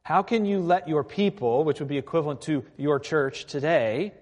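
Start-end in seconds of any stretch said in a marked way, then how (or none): 0.0-1.6 higher, mildly heated protest; indignant Habakkuk. Retold or imitated. Prophet protests to God about his people, mildly indignant. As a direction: challenge it with a touch of outrage. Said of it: "Habakkuk is shaking his fist at God".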